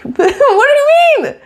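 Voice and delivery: very high, slow, laughing "what do you mean"; laughing disbelief. Retold or imitated. Imitating the fan, slow, very high, laughing in disbelief. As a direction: stretch it out through a laugh. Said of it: "I'm like".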